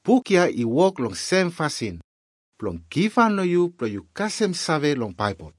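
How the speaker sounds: normal talking voice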